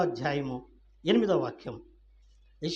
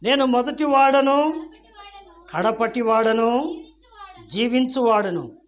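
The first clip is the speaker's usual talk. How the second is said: loud, slow, stretched declaration; raised pulpit reading, each phrase held; proclaimed scripture; 6 semitones higher, 7 dB louder, slower than usual. Acted. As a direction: declare it grandly in the Lord's words.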